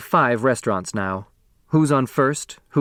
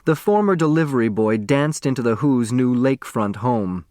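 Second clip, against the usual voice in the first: ordinary delivery, nothing marked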